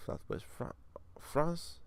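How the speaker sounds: put-on slow, slipping French accent; half-hearted French accent; attempted French accent. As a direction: read it slowly with faked French. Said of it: "I just lost my accents completely".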